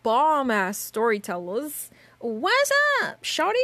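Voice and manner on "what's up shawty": playful high greeting. Pretend.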